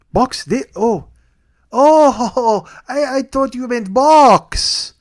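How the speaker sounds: high, loud, drawn-out mock realisation; hammed-up clerk's dawning comprehension; confused clerk realising. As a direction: stretch it slowly, high and loud. Mimics confused clerk finally realising.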